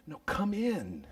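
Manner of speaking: soft pleading invitation; gentle coaxing. Acted. Father to pouting son outside, soft and coaxing. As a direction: coax him in gently.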